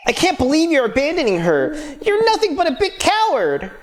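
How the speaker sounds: high-pitched, outraged accusation; acted indignant outburst. Acted quarrel in game dialogue.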